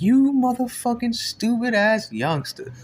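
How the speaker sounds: loud, high, slow insulting shout; angry yelling. Retold or imitated. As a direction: spit out each insult. OG yelling at him, slowed.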